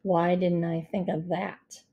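low, deadpan sarcasm; dry, toneless mockery; sarcastic. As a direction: feign admiration in a bored monotone. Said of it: "a sarcastic manner".

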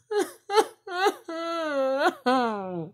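high, drawn-out laugh; long high-pitched laughing fit; real laughter. Real, not acted. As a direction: crack up helplessly. Laughs over Kairi Sane being gone.

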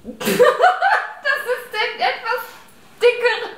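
slow words squeezed through giggles; fighting back laughter; stifled giggles. Real, not acted. Trying not to laugh.

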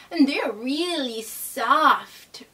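usual speaking voice

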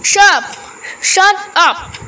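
headmaster's high, forceful rebuke; sharp order. A narrator's reading. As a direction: cut the boy off sharply. Headmaster silences the pleading boy.

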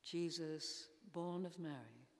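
usual speaking voice

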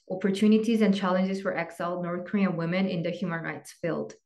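ordinary talk

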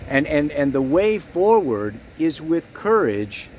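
ordinary talk, nothing marked

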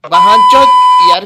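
high, loud furious curse; angry yell. Acted. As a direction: bellow the curse across the house. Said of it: "বালা, এত চেঁচাচ্ছিস?".

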